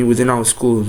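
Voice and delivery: ordinary talk, nothing marked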